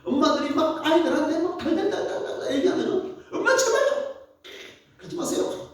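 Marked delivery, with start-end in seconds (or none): 3.3-5.7 put-on much higher pleading kid voice, fading to a breathy end; whiny child. A child pleading with a nagging mom, high whine. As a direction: beg like a fed-up kid.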